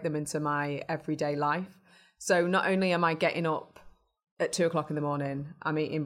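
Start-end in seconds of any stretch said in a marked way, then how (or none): none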